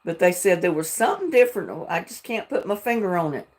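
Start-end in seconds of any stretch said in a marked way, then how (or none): none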